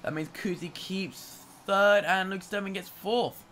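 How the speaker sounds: loud, fast, excited race call; excited commentary. Real, not acted. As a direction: call the overtake at full pitch.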